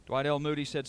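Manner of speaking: ordinary delivery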